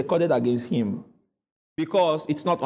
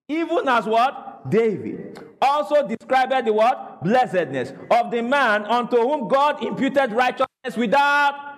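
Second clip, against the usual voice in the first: higher, emphatic preaching read; raised-pitch call-out reading with prompting questions; scripture read-along; 5 semitones higher than usual. Real, not acted. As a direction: declaim the verse for the congregation to follow. Leads congregational scripture reading.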